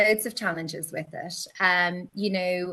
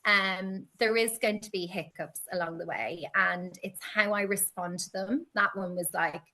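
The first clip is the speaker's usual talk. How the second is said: normal talking voice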